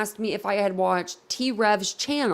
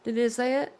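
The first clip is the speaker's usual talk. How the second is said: ordinary delivery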